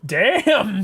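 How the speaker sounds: ordinary talk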